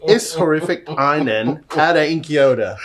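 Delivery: loud, hammed-up Cockney with no H's; broad, loud put-on Cockney; Cockney accent. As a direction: belt out the tongue-twister in mock Cockney. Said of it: "test of his Cockney accent".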